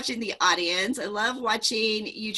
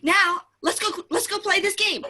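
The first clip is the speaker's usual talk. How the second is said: hyper Mark on coffee; 8 semitones higher, 5 dB louder, faster than usual. Retold or imitated.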